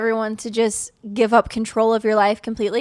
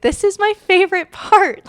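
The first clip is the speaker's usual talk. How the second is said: higher, delighted voice of a gently amused Jesus; Jesus laughing; 9 semitones higher, 3 dB louder than usual. Retold or imitated. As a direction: lift into a playful, delighted other voice. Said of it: "felt Jesus laughing and being like".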